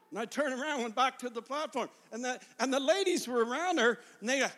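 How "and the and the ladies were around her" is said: usual speaking voice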